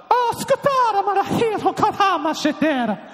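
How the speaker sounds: very high-pitched babbled question; mock foreign gibberish. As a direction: babble made-up words up high. Fake foreign gibberish prank, acted.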